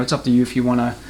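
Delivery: ordinary delivery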